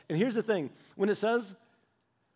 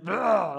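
louder mock groan of disdain; disgusted groan; 2 semitones higher, 6 dB louder than usual. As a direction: imitate a scornful "ugh". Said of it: "with an attitude towards men".

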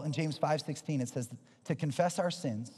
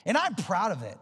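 raised, high-pitched sermon voice; impassioned preaching; 10 semitones higher, 5 dB louder than usual. Acted. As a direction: preach it with driving conviction. Preaches at full force.

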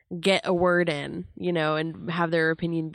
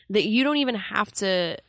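ordinary talk, nothing marked

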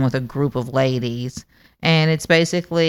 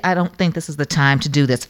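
ordinary talk, nothing marked

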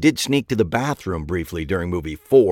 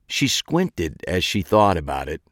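ordinary delivery

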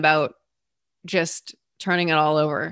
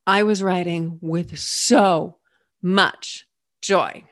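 usual speaking voice

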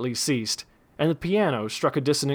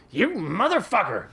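high furious shout; 7 semitones higher than usual. A narrator's reading. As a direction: bellow it at him. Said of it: "shouted Daggett once again".

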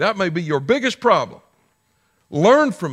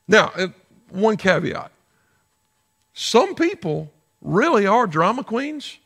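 ordinary delivery

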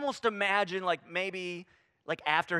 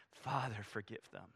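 much softer, lower, near whisper; hushed prayer; 8 semitones lower, 7 dB quieter, slower than usual. Acted. Jesus praying from the cross, hushed. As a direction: breathe it out as a quiet plea.

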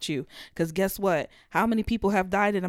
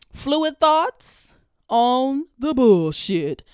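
slow, drawn-out announcer voice, louder on the title; segment announcer; 4 semitones higher, 6 dB louder, much slower than usual. Acted. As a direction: stretch the title out like a show intro. Announcing her podcast segment name.

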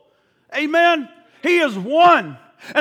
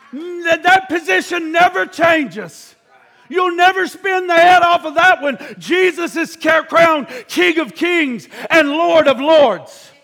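full-force preaching; 3 semitones higher, 4 dB louder than usual. Acted. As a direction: proclaim it like a crowning.

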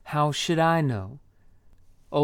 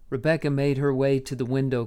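ordinary delivery

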